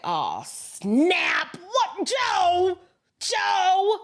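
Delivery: searching the room for a missing Joe, mock-frantic; mock panic. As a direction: aides suddenly noticing Joe is gone, hammed-up panic. Staff discovering Joe missing.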